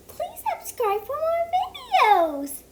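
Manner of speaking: very high squeaky puppet voice, quick; a cartoon pig introducing itself; Piggy voice. Piggy puppet says hello to the viewers, high and squeaky. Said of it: "my name is Piggy".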